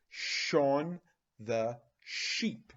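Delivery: Shaun the sheep said very slowly; slow, clear naming. Shown as a demonstration. Teacher naming the character slowly for kids. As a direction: stretch every sh. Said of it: "two words with the sh".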